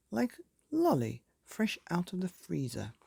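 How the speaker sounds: plain reading voice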